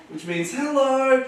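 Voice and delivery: normal talking voice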